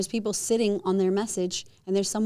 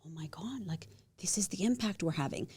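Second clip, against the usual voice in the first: husband's whisper; 8 dB quieter than usual. Retold or imitated. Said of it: "He was like".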